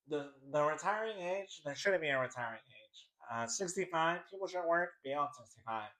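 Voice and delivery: soft, put-on mocking voice; Ben Shapiro. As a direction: mimic a pundit in a small, lifted voice. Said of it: "talks like this".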